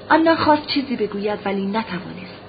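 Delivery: ordinary delivery, nothing marked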